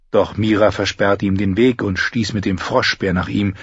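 usual speaking voice

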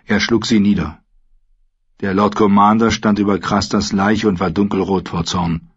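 ordinary delivery

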